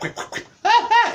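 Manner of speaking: high falsetto yelps between whacks; mock whack and scream. Boy acting out the switch hitting him, high mock yelps. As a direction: shriek each whack like a small child. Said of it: "screaming on top of my lungs".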